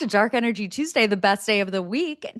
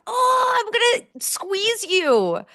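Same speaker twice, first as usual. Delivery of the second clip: high, slow squeaky coo; cute-aggression coo; 14 semitones higher, slower than usual. Retold or imitated. Aunt gushing at chubby toddler, high slow coo. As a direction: squeeze the words like a chubby toddler.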